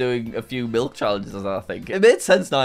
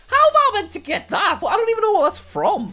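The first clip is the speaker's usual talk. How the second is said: baffled; 6 dB louder than usual. Real, not acted. Baffled by pixelated thumbnail quiz.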